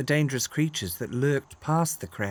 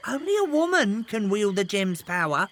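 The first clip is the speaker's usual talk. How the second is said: lightly voiced bird; 5 semitones higher than usual. Misty the bird reveals secret.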